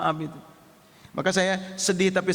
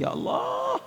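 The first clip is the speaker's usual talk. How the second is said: pleading cry; 4 dB quieter, slower than usual. Acted. Pleading prayer to God.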